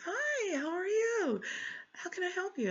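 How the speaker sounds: very high, sing-song mock greeting; sugary librarian greeting. Mocking the librarian's sweet greeting at the desk, very high sing-song. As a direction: chirp it like an overly sweet clerk.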